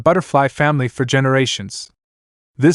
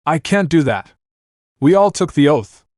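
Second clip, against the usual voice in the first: yelling back; 4 semitones higher than usual. Acted. Luna refusing the entity, mild yell. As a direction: call back over the noise, mildly. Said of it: "Luna yelled back".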